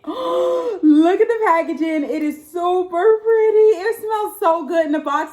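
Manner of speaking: high, loud gasp and gush; thrilled, almost crying with joy; gasping delight. Real, not acted. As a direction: gush over the pretty packaging. Gasping over candle packaging.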